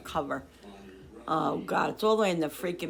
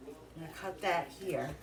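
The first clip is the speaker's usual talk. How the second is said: normal talking voice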